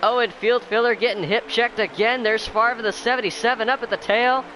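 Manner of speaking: high, loud, racing announcer hype; excited play-by-play. Real, not acted. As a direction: call the restart at full throttle. Hyped restart race call.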